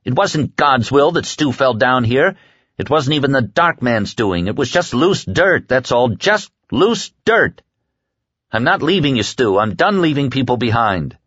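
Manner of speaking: high-pitched, worked-up argument; upset protest. Acted. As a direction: argue desperately against leaving him behind. Larry's furious refusal to abandon Stu.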